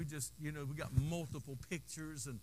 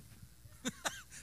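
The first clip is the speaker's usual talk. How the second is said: high laugh; 8 dB quieter than usual. Real, not acted. Laughs at own joke.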